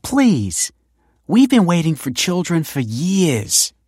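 desperate plea; imploring. A narrator's reading. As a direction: beg for the children she waited years for. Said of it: "implored the woman".

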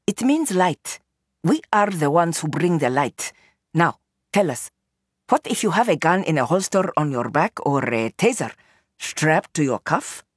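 put-on foreign-accented woman, composed and challenging; Nur, accented English. Nur to the reporter she suspects, accented challenge. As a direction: question him in careful accented English.

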